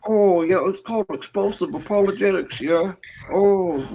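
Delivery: high, folksy Canadian put-on; mock Canadian accent. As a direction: explain it in a hammed-up Canadian accent. Back in the joke Canadian voice.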